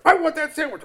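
very high, loud whiny demand; squabbling cub. Acted. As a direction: whine like a greedy cub. Cubs arguing over sandwich.

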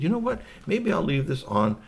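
lightly voiced high alien; a hint of a high space-creature voice; musing alien. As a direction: pitch it up, hatching an idea. Alien decides aloud.

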